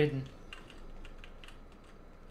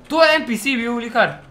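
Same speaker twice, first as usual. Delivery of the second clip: excited shout; 5 semitones higher, 13 dB louder than usual. Real, not acted.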